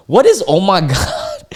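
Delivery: high incredulous squeak, laughing; baffled mocking. Real, not acted. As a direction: scoff at his words, high and stunned. Mocking his phrasing.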